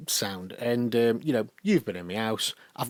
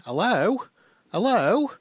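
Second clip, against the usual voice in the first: imitating Kathleen calling; 9 semitones higher, 4 dB louder, slower than usual. Kathleen calling on bad line.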